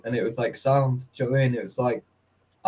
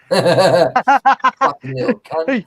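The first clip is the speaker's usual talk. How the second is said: slow, laughing and louder; laughing insult; 8 semitones higher, 7 dB louder, much slower than usual. Real, not acted. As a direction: spit it out through laughter.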